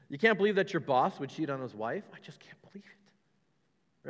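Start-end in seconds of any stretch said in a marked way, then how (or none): 2.1-4.1 whispered, hushed mock disbelief. Preacher mocking shock at a cheating boss, whispered. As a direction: breathe it like a scandal.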